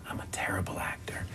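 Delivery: unvoiced confiding whisper. Malkovich leaning over to confide to Matt Damon, whispered. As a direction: lean in and whisper it. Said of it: "he leans across the table. He goes".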